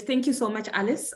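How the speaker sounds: ordinary talk, nothing marked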